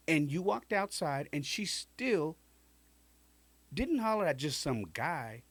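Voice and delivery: high, incredulous cousin voice; exasperated cousin. Retold or imitated. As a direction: lay out the facts like a fed-up cousin. Cousin lecturing him, pitched up.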